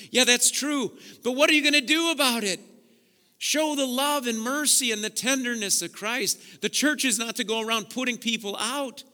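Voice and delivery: loud, soaring preacher's call; preaching at full force. Acted. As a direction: preach it out to the whole church.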